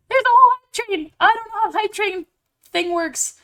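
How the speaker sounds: high, overwhelmed exclaiming; flustered excitement. Real, not acted. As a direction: exclaim in happy panic. Hype train starts unexpectedly.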